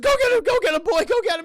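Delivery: high, loud, excited urging; mock urgent shouting. Streamer as dad sending the dog after Billy, mock shouts. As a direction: yell to send the dog running.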